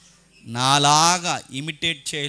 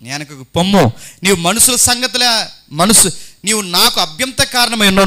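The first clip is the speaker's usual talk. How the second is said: loud stern rebuke to Peter; 5 semitones higher, 11 dB louder than usual. Retold or imitated. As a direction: drive him back with a loud rebuke. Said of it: "ఆయన పేతురు వైపు తిరిగి". (పేతురును గద్దిస్తూ బిగ్గరగా కఠినమైన మందలింపు)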